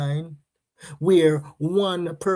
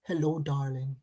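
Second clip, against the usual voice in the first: softer, lower mock American greeting; mock posh American; 5 semitones lower, 7 dB quieter than usual. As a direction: imitate a smooth American charmer, quietly. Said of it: "He speaks standard American English".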